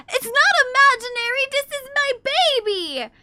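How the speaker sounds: hammed-up outrage at the teasing; defending the pretend baby loudly to chat; mock indignant shout.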